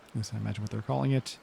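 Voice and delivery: normal talking voice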